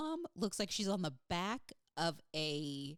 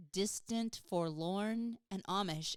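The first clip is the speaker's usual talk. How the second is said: ordinary talk, nothing marked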